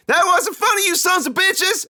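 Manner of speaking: high, loud and furious; furious yell. Acted. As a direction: scream it while hitting Mitch. Kid attacks pranksters.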